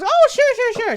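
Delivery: very high, loud, jolly chatter; bubbling over with cheer; jolly halfling woman. Acted. Jolly halfling woman chatting eagerly with a fellow halfling. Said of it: "loud and boisterous and jolly".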